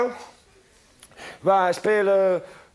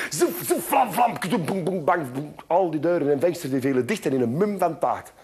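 usual speaking voice

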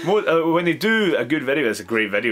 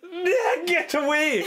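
high squealed retort, re-enacted; hammed-up fright, voice pitched way up; mock panicked recoil; 14 semitones higher, much slower than usual. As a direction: shoo him off in acted panic. Acts own recoil from spider.

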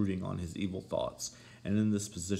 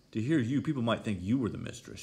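loud, raised-pitch reproach; crying out; 4 semitones higher, 6 dB louder than usual. A narrator's reading. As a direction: scold a servant who oversteps. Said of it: "she cried".

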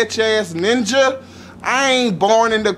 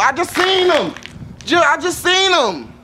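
indignant; 7 semitones higher than usual. Real, not acted. Indignant about maintenance men.

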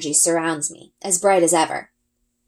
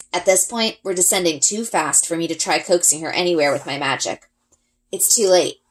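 ordinary delivery, nothing marked

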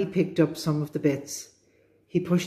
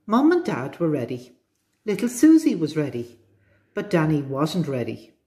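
ordinary delivery

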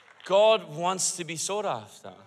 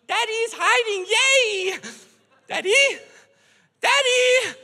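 put-on much higher, tiny child voice ending in a call; little girls' voices; 12 semitones higher, slower than usual. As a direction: play the sweet little daughters calling for dad. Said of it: "their little angelic voices going".

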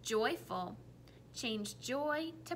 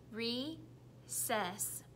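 normal talking voice

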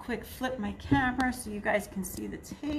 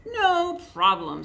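cheery; 9 semitones higher, 6 dB louder than usual. Acted. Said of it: "said Goose".

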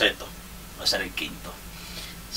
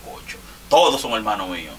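usual speaking voice

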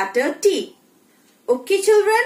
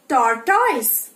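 ordinary delivery

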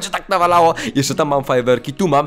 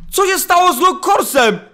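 baffled surprise; 10 semitones higher than usual. Real, not acted. Streamer amazed his mob nods at him. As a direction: sound truly stunned by what the mob did.

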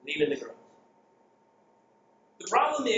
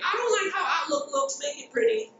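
much higher, imitating a fussy user; hammed-up high complaint from a user; mock user complaint; 8 semitones higher than usual. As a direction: pipe up like a user whining about Outlook. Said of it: "going to work and saying".

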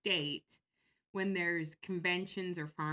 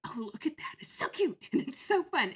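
high, louder mock delight; mock gushing; 8 semitones higher, 6 dB louder than usual. Shopper at the mall with David, mock gushing. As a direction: coo in exaggerated delight. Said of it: "and I'll be like".

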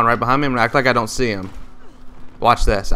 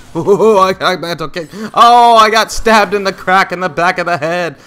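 mock lament; 7 semitones higher, 5 dB louder than usual. Real, not acted. Gamer wailing after getting stabbed in the back. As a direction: wail it like a mock tragedy.

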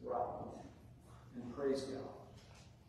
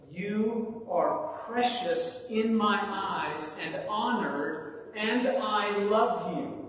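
raised, slow, ringing declaration of love; voice of Jesus; 6 semitones higher, 5 dB louder, slower than usual. Acted. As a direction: proclaim it slowly as a Savior's promise. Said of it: "the voice of Jesus speaks as well".

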